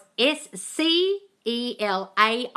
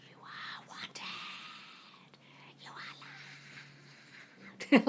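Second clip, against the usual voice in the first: slow whispered affirmation; ASMR whisper; 4 semitones higher, 13 dB quieter, slower than usual. Shown as a demonstration. Answering a viewer's request, whispering affirmations ASMR style. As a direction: breathe it out in a soft ASMR whisper. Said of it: "find a way to rant in A S M R".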